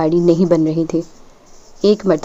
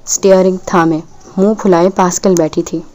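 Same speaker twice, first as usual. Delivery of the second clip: ordinary delivery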